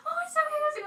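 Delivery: high soothing reassurance. Retold or imitated. Imitating the actress comforting a crying fan, high and soothing. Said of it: "She was like".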